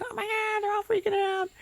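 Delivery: very high, soft cartoon panic; baby carrots in tiny mock panic; squeaky carrot panic.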